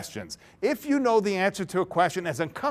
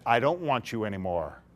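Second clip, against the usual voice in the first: lower, softer cold dismissal; cold rejection; 7 semitones lower, 5 dB quieter than usual. Acted. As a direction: drop the bomb coldly. Mister B rejects Miss A.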